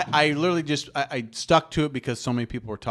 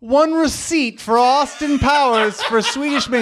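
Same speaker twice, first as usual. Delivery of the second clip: slow, much higher, louder mock reading; mock officer's inventory; 11 semitones higher, 7 dB louder, slower than usual. S1 retells Austin Powers, officer reads the evidence slowly. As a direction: drawl out the list. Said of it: "He's like".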